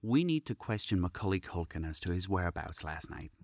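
low dry police officer voice; deadpan cop. Acted. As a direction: state it flatly like a detective.

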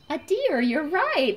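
high, loud cheer for the right answer; excited praise. Acted. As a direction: cheer like a delighted teacher. Praises child's guess excitedly.

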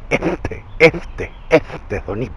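lisped, spitty "este"; ceceo escupido. Shown as a demonstration. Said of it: "hace ese pequeño sonido". ("este" ceceado, como que escupe)

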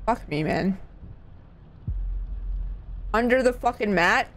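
normal talking voice

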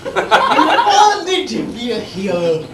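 normal talking voice

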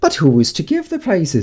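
high character voices, one after another; a crowd of creatures asking in turn; chorus of voices. A narrator's reading. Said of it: "quite a chorus of voices asked".

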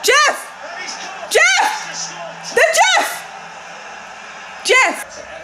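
very high, strained yells of a name; excited cheering. Real, not acted. Fan cheering ex-Arsenal sub Jeff, high excited yells. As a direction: hail your favourite player as the goal goes in. Cheering a player after goal.

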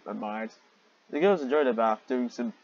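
usual speaking voice

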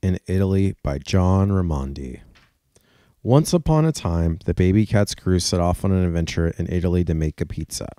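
lively storybook narration; animated picture-book reading; reading to children. Acted. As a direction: read it out to little kids. Reads children's story aloud.

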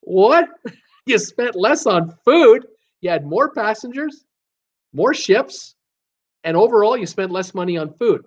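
high, disbelieving, half laughing; incredulous. Retold or imitated. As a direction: act shocked at the news. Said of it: "I'm thinking".

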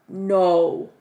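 loud, drawn-out no; loudly amazed; disbelieving. Real, not acted. Can't believe the planner's features.

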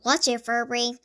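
usual speaking voice

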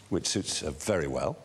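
usual speaking voice

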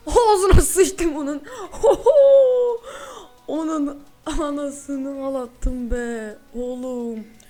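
loud, slow gloating with a laugh; crowing over the defeated shark; triumphant gloat. Real, not acted. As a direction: savour the win out loud. Celebrating the kill.

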